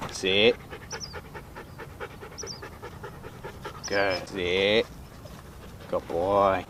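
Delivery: very low, soft dog commands; talking to a dog. Real, not acted. Sit and praise.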